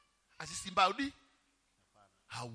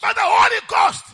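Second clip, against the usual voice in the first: preaching at full force; 12 semitones higher, 23 dB louder than usual. Real, not acted. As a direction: thunder it out to the congregation. Preacher shouting to the church.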